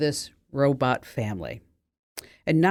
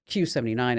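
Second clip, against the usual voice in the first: ordinary delivery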